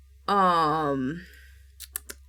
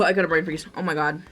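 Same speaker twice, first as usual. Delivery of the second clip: usual speaking voice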